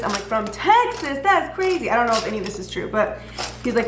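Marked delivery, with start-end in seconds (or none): 0.2-1.9 high-pitched retold disbelief; acted amazement. Reacting to a stranger's long walk, acted amazement. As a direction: play up the amazement, rising high. Acts out amazement at his story.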